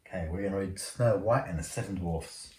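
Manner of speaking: usual speaking voice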